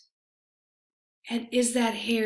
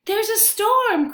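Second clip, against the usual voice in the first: higher, louder alarmed cry; cried out; 10 semitones higher, 4 dB louder than usual. A narrator's reading. Hare cries out to friends about the storm, high and loud. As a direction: call out the danger. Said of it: "cried Hare".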